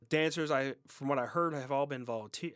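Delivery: ordinary talk, nothing marked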